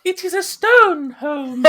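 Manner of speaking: high-pitched voice. Shown as a demonstration. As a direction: jump to the top of the range. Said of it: "go complete opposite end of the scale".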